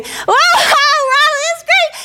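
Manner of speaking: very high squealed cheering; mock scream, extremely high-pitched; screaming with glee. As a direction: shriek it like a thrilled kid being spun. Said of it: "we start screaming".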